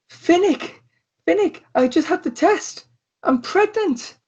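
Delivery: put-on high, squealing girlfriend voice; excited girlfriend. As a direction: burst out the news in a high girlish voice. GM voices Josie announcing pregnancy.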